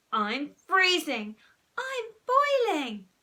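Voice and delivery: loud, high, stretched-out whine; squabbling children's yells; yelled complaint. A narrator's reading. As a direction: wail it in protest. Brothers yelling complaints over each other.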